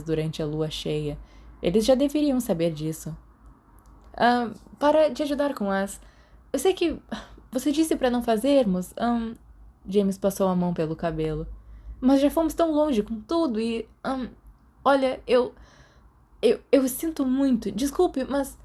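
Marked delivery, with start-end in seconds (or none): 4.1-9.3 high, flustered stammer; nervous James. A narrator's reading. James nervously stammers to Remus, high and flustered. 12.0-18.6 high, flustered stammer; nervous James. A narrator's reading. James nervously stammers to Remus, high and flustered.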